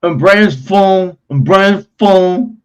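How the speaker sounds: slowly repeats the phrase, leaning on Brian's; slow, pointed repeat. Real, not acted. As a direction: spells out the claim in slow disbelief. Repeats the claim in disbelief.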